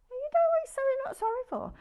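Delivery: very high, squeaky teasing question; mock exasperation. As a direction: squeal it in playful frustration. Mock exasperation at viewer's secret.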